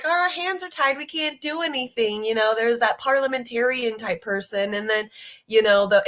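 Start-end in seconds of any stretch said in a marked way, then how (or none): 0.0-2.2 high whiny mock voice; mocking Democrats. Mocking politicians who claim their hands are tied, high whiny. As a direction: whine it like a helpless politician. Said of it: "they were like".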